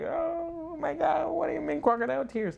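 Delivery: boyfriend mimics his girlfriend's shocked reply, high and mocking. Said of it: "she's gonna be like".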